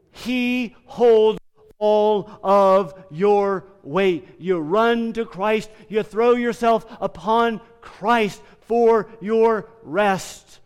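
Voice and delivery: loud and slow, building to a climax; preaching at full force. Real, not acted. Pastor urging the congregation to run to Christ, loud and slow. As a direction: drive it home with full force.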